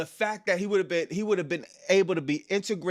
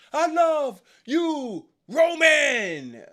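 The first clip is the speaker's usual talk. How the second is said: high, slow, hammed-up fan yell; mock fan shout; 10 semitones higher, 4 dB louder, much slower than usual. Mocking the fans, high slow shouted declaration of love. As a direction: bellow it like an overeager fan in the crowd.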